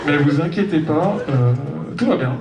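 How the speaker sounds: calm airline captain on the intercom; pilot announcement. Pretend. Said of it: "l'annonce du commandant de bord".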